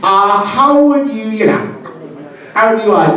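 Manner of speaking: high, halting and tentative; fumbling question. Retold or imitated. Parishioner struggling to ask a priest, high and hesitant.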